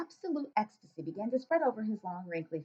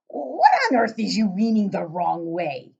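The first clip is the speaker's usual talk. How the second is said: booming, slow giant with odd grammar; loud giant voice; 9 dB louder, slower than usual. Acted. Giant questioning the girl, booming and puzzled.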